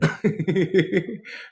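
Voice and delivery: high giggle at own joke; amused laugh. Real, not acted.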